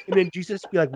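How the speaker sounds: usual speaking voice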